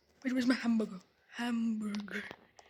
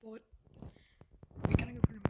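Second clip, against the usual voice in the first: ordinary talk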